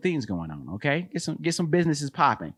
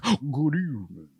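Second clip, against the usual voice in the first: Alfred Hitchcock; 4 dB quieter than usual. Retold or imitated. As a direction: greet them the way Hitchcock would, slowly. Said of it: "like Alfred Hitchcock".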